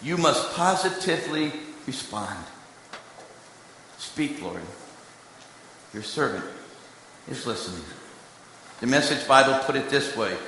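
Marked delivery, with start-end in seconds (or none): 4.0-7.8 reverent prayer. Acted. Prayerful quote of Samuel.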